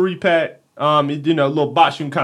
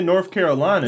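normal talking voice

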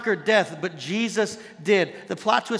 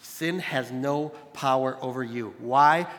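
ordinary talk, nothing marked

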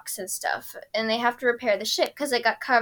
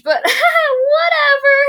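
laughing shriek; 11 dB louder, much slower than usual. Real, not acted. Laughing punchline.

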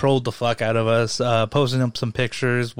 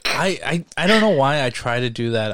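ordinary delivery

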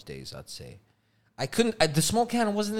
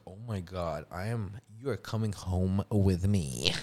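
hushed delight; 9 semitones lower, 7 dB quieter than usual. Retold or imitated. Retelling finding at supermarket.

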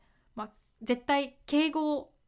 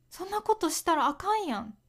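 quick, higher-pitched Kansai accent; 関西弁; 6 semitones higher, faster than usual. Shown as a demonstration. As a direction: chide briskly in Kansai dialect. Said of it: "関西では使いますね。". (関西弁で高めに早口で)

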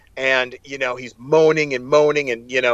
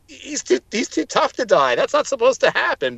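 high, incredulous protest; disbelieving protest; 10 semitones higher than usual. Retold or imitated. Reader reacting to a hero's death, high disbelieving protest. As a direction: refuse to believe he could die.

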